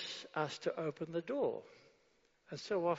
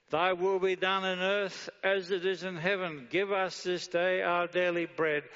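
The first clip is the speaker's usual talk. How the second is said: louder, forceful proclaimed prayer; preacher proclaiming the prayer to the church; preaching the prayer; 3 semitones higher, 7 dB louder than usual. Acted. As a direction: declaim it from the pulpit.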